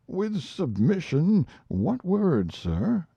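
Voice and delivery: bow and scrape with the question; respectful old clerk. Acted. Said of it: "respectfully crowding himself".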